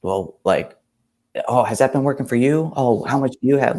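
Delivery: put-on ex-girlfriend snapping sarcastically at him; snappy sarcastic jab. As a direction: throw the success back at him with a sneer. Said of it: "snapped and was like".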